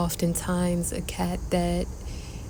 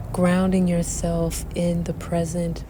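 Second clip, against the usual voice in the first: guided meditation. Acted. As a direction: guide the breath calmly. Leads a breathing meditation.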